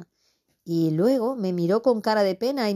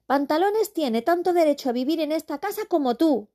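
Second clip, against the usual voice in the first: put-on indignant boy; 10 semitones higher than usual. Boy defends the cat.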